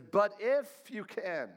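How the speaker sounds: a desperate father stretching out his hope slowly; desperate plea. Retold or imitated. Father begging the disciples' master, slow and desperate. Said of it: "the dad is desperate".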